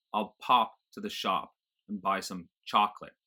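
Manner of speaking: American open AH in pop, shop, chocolate; General American accent. Shown as a demonstration. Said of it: "Nothing like that in general American".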